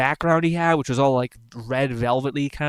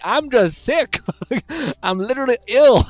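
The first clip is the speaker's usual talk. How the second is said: higher, louder mock protest; pleading sick person; 9 semitones higher, 6 dB louder than usual. Accused sick person protesting to villagers, higher mock plea. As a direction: insist you're only ill. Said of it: "And you're like".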